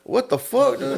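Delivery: high-pitched retold bewilderment; baffled disbelief. Retold reaction to being confronted.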